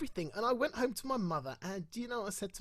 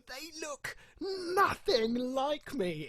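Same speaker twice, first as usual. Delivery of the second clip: incredulous; 6 semitones higher, much slower than usual. Retold or imitated. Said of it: "and you go".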